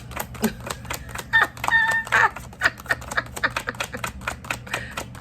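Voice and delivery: a long squealing burst of laughter; breathless high-pitched laugh. Real, not acted. Laughs at own anger.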